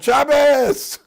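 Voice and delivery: loud, high, drawn-out yell; retold yell. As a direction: cry it out like a spontaneous protest. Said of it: "he yelled".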